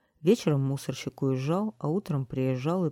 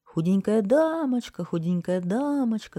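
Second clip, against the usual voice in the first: high, raised-voice call; crowd calling out; 6 semitones higher, 4 dB louder than usual. A narrator's reading. Townspeople all calling the thin lady at once, shouting. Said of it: "закричали все разом".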